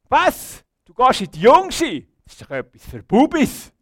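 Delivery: loud, high mocking outburst; scornful disbelief. Friends ridiculing someone for going to Jungschi, loud scornful disbelief. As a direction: scoff loudly in exaggerated shock. Friends scoff at the youth group.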